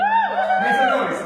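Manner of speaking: huge, high, drawn-out yell; hype shout. Pretend. Speaker whips up audience for Eric Thomas, roaring shout. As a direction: bellow it like a stage hype man.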